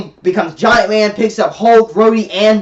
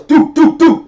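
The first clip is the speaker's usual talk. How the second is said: raised mock blast noises; blast sound effect; 7 semitones higher, 5 dB louder, slower than usual. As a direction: mimic the ship firing. Imitating Thanos blasting facility.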